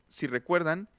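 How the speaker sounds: normal talking voice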